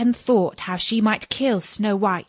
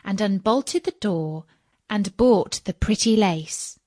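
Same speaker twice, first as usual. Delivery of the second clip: ordinary delivery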